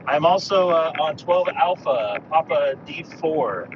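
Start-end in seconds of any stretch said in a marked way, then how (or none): none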